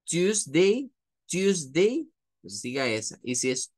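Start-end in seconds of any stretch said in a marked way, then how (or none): 0.0-2.1 "Tuesday" as "tusde", rising; model pronunciation. Shown as a demonstration. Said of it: "Si le es más fácil decir". ("Tuesday" como "tusde")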